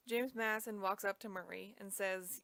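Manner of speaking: ordinary delivery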